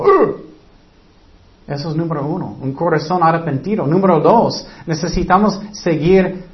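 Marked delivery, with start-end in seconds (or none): 0.0-0.4 sharp, very high, loud grunt of annoyance; annoyed ugh. Acted. Preacher acting someone offended by a rebuke, loud high ugh. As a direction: snap out an offended groan. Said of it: "O enojas tanto".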